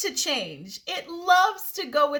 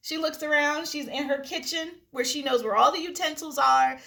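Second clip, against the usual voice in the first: normal talking voice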